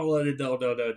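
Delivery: plain reading voice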